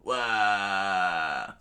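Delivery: drawn-out, half-hearted 'wah' yell; playful holler of alarm; mock scream. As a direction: yell it long at your own pitch. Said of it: "Luffy screamed out".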